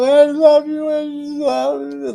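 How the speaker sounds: high, loud gushing imitation; gushy mock voice. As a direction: ham up the overflowing love. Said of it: "people are like".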